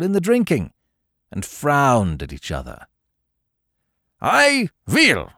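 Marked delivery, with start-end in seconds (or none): 4.2-5.3 high-pitched exclamation in the Baron's voice; sudden cry. A narrator's reading. Baron suddenly addresses his drinking companions, high sudden cry. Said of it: "cried the Baron suddenly".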